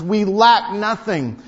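slow and loud, weight on lack; slow emphatic. Acted. Preacher closing his point to congregation, slow and loud. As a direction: land it like a verdict. Repeated climax line.

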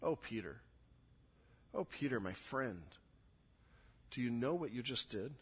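soft, pained reproach; sorrowful. Acted. Jesus looking at Peter after the denial, sorrowful. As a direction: say it quietly, grieved for a friend. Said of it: "A look of sorrow and pain".

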